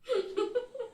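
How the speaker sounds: a bright burst of giggling; amused giggle. Real, not acted. Vlogger giggling at her own onion tears pun, amused giggle.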